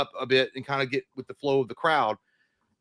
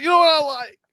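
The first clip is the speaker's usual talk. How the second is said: high, loud drunk imitation; drunken garble; 12 semitones higher, 7 dB louder than usual. Drunk man giving his order to his partner, high garbled speech. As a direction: slur it out high and loud, barely intelligible. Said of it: "I don't even know how she understood me".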